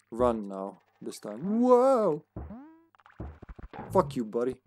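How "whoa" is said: startled whoa. Real, not acted. Sudden startled exclamation.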